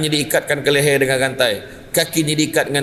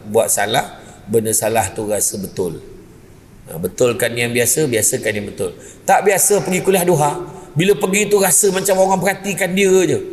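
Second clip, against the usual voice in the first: ordinary talk, nothing marked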